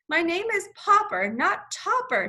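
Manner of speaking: mild shout of a frustrated man. A narrator's reading. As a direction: correct him with a shout. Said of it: "shouted Mister Popper".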